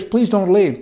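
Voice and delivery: a hint of a whiny husband begging his wife to stay; mock begging husband. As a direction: beg like the clingy husband, half-hearted. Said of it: "He will beg you to stay".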